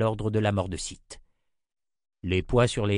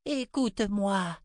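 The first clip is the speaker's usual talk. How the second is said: pleading woman; 11 semitones higher than usual. Acted. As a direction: urge an old friend to listen.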